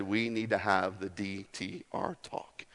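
soft, low Jesus. Retold or imitated. Jesus voiced softly.

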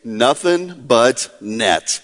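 low, slow, spaced-out ad phrase; catchphrase imitation. Quoting the basketball players' phrase from the ad, low slow catchphrase. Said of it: "they coined the phrase".

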